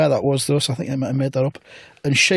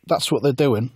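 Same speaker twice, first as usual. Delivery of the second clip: ordinary talk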